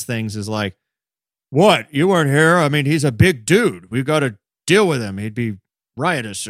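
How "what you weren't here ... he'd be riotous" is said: louder, mildly put-on officer quote; retold defensive officer. Host imitates officer answering pleading bystanders, defensive. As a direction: push back loudly, as if justifying yourself.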